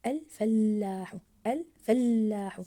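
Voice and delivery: "الفلاح" very slow, the doubled ل held long; stressed doubled letter. Shown as a demonstration. Teacher rereading for pupils with the shadda, stressed doubled letter. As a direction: stretch and press the ل after ف in "الفلاح". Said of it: "مع الانتباه لللفظ للحرف المضاعف".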